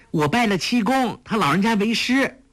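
put-on high, bright girlish voice; proud young girl. A narrator's reading. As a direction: Huang Rong tells her father of her new master, girlish. Huang Rong voiced.

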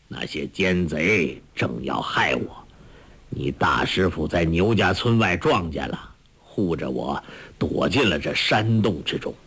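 low, easygoing elder; old beggar master. Acted. Old master consoles the weeping disciple, low elder's voice.

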